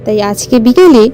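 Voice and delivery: plain reading voice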